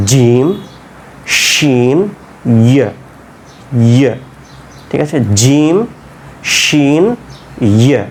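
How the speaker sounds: crisp palatal Arabic letters; teacher modelling palatal letters; Arabic jim shin ya. Shown as a demonstration. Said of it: "শক্ত করে একবার জিহ্বাটাকে ওপরে".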